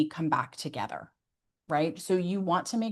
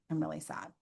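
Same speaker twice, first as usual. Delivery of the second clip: much softer, quiet admission; softly sad; 2 semitones lower, 11 dB quieter than usual. Shown as a demonstration. Parent telling a child they feel sad, softly. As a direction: say it barely above a breath. Modelling telling kids your feelings.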